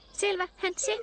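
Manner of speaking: high, bright reply; cheerful reply. A narrator's reading. Charlie Chip welcoming Pino home, cheerful. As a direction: say it gladly. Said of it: "sanoi Charlie Chip iloisena".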